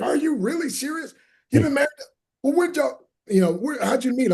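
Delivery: high-pitched, incredulous questions; amazed questioning. Retold or imitated. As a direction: act out astonished strangers asking. Retold amazed questions from people.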